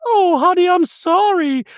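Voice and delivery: high, prim voice for Dorit; Dorit impression. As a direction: play Dorit sweetly apologetic in a high voice. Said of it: "flash back to Dorit going".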